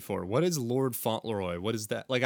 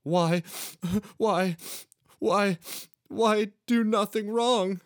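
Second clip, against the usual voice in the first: hammed-up sobbing wail, higher and louder; mock hysterical crying; 11 semitones higher, 5 dB louder, slower than usual. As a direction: fake a hysterical cry, pitch climbing with each why. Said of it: "I said, hysterically crying".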